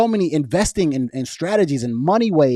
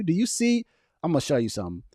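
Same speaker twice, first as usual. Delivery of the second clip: normal talking voice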